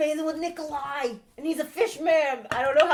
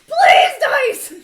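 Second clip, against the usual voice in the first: excited shout; 11 semitones higher, 11 dB louder than usual. Real, not acted. Begging the dice roll.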